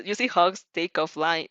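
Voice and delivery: ordinary delivery, nothing marked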